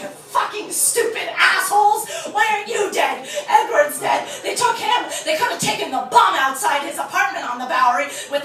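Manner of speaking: teacher raging at his actors over Edward's death; furious screaming rant. Retold or imitated. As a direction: scream at the whole class.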